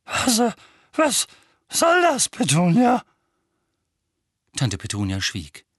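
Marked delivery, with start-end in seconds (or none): 0.1-3.0 panicked. Acted. Vernon panics.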